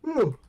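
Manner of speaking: big high sighing yawn; yawning out loud. Real, not acted. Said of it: "Sorry for yawning so much".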